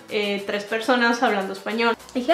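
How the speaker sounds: ordinary talk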